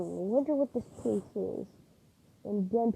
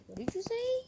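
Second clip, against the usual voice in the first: not like that. Shown as a demonstration. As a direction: squeal the question with an exaggerated pitch. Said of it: "Not saying it like that".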